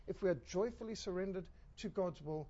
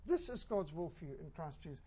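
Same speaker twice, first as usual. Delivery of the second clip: ordinary talk, nothing marked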